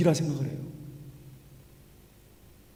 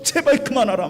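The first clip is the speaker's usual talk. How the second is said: raised, high-pitched angry plea; acted fury; 7 semitones higher than usual. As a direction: play the fuming king demanding a halt. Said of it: "얼마나 열받았는지".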